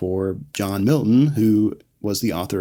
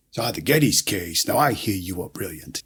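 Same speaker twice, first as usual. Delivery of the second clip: quieter, lightly voiced sly questioner; Milton impression; 3 semitones lower, 5 dB quieter than usual. Milton sounding out Kevin on the case, lightly acted and soft. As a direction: drop a little into Milton's probing voice. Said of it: "Milton asks about the Geddes case".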